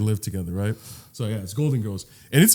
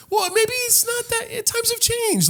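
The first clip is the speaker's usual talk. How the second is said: much higher, put-on old lady tone. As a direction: play a prim elderly friend. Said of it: "the older best friends are like".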